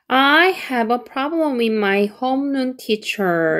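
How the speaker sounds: very slow, each word clear; slow model sentence; slow careful read. Shown as a demonstration. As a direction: read it slowly for learners. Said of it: "이렇게 되는 거죠".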